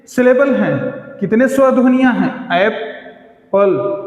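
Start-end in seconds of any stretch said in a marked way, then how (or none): 2.6-4.0 Apple split slowly as ऐप, पल; slow teacher's demo of Apple, pause between syllables. Shown as a demonstration. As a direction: break Apple into two separate beats, ऐप and पल. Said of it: "दो भाग है".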